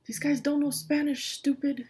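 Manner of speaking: usual speaking voice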